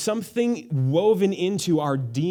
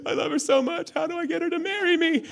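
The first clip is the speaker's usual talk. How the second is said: put-on much higher, pleading lovestruck young man; lovesick plea; 12 semitones higher than usual. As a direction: beg like a smitten guy asking for help, high voice. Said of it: "you're gonna say".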